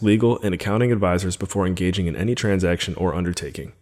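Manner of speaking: quick, even disclaimer read; fast legal disclaimer. Acted. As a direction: rattle off the legal fine print.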